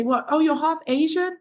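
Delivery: high, puzzled question; mock surprise. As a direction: act confused and surprised. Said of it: "they say".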